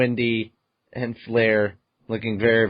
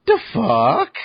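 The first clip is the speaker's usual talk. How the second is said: higher, louder baffled question; 7 semitones higher, 4 dB louder than usual. Retold or imitated. Reacting to the hidden spirit, baffled question. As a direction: spit it out in disbelief. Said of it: "And I'm like".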